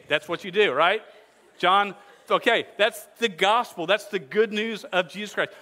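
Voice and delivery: ordinary delivery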